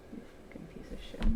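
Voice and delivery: fast whispered swearing; muttered curse. Real, not acted. Trader cursing the market in a fast whisper. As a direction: spit it out under the breath.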